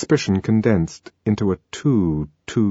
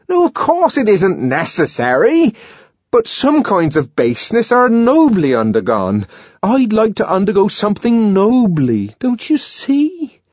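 put-on high, earnest young man's voice; John pleading; 12 semitones higher, 5 dB louder than usual. A narrator's reading. John urging Lenina to see his noble wish, earnest. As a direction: press him to make her understand.

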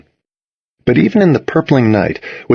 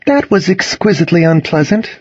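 dry Cassidy; 9 semitones higher than usual. A narrator's reading.

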